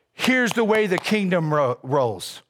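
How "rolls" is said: normal talking voice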